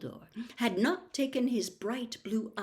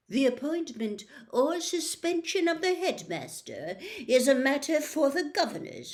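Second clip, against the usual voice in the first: mild, smooth and assured; smoothly; 6 semitones higher, 4 dB louder than usual. Acted. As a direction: glide over the objection. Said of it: "said Mister Malfoy smoothly".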